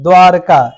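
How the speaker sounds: द्वारका with no i after r; correct pronunciation. Shown as a demonstration. Teacher giving the right word, no 'ri' in it. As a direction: go straight from r to ka in द्वारका. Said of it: "सही शब्द है".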